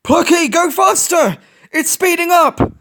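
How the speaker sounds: high, loud, slow call; shouting from above. A narrator's reading. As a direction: urge him on from overhead. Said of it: "Plucky shouted from above".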